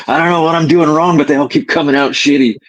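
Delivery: high, loud exasperated outburst; frustrated self-quote. Retold or imitated. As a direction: vent it like nothing is working.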